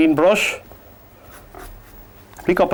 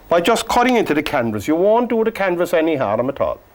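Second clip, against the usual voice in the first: usual speaking voice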